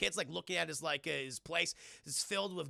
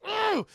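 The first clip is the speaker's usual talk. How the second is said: loud groan; 10 dB louder than usual. Retold or imitated. Exasperated groan.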